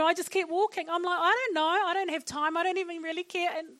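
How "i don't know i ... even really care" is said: high-pitched, impatient inner monologue; mock dismissiveness. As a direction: act out brushing someone off as you walk on. Acts out own hurried dismissive thought.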